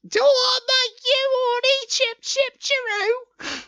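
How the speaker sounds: sing-song like a cheeky cartoon sweep; high squeaky randy chimney sweep. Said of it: "a very Randy chimney sweep".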